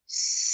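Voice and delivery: a steady hissing s on the out breath; noisy s sound exhale. Shown as a demonstration. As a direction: let the breath out as a long s. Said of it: "You could try a S sound".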